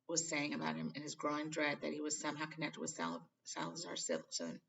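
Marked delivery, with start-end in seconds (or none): none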